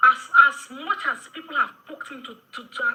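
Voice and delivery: loud, with a laugh breaking through; laughing while talking. Real, not acted.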